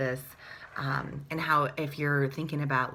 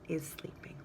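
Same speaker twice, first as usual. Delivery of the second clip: breathy hushed refrain; whispered; 10 dB quieter than usual. A narrator's reading. Reading to children, whispering the sleepy refrain.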